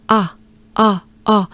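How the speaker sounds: "ah" as in awe, French-accented. Shown as a demonstration. Teacher models the vowel for English speakers, French accent. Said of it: "with a French accent".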